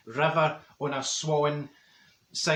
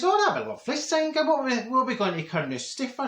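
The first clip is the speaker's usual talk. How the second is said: high, gushing "oh that'd be lovely"; delighted reply; 8 semitones higher than usual. Retold or imitated. Marjorie thrills to Senga's offer of a day trip, high and gushing. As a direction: gush with pleasure at the invitation.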